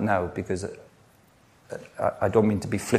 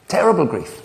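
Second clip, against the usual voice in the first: anguished emphasis; 9 semitones higher, 4 dB louder than usual. Acted. Preacher stresses grief.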